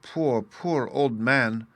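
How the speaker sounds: very slow, choked little voice; half-choked sobbing. A narrator's reading. Little boy pitying the beggar, choked sobs. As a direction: pity him through sobs. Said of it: "half choked with sobs".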